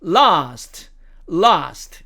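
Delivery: British "last" with long ah; British demo of "last"; British accent. Shown as a demonstration. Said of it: "영국식 발음으로 가면".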